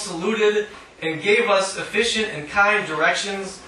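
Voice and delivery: measured, deliberate reading voice; poetry reading. Acted. Poet reading her own poem to an audience, measured reading voice. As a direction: read each line out to the room.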